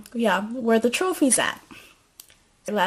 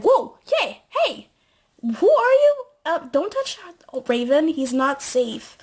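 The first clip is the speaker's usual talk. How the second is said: startled challenge; 6 semitones higher than usual. Retold or imitated. Zoe catching a stranger petting her horse, high and alarmed.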